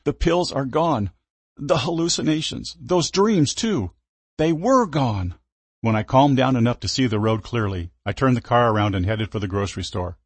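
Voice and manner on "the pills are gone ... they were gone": high, slow tearful voice; crying. Acted. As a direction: speak through sobs. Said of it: "I said through gasps and hitches".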